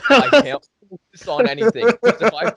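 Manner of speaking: high-pitched retold freak-out; mock panic. Acts out panic at spinning room.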